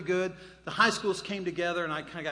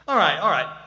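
loud settling; 11 dB louder than usual. Real, not acted. Settling laughing audience.

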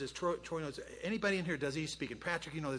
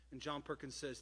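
ordinary talk

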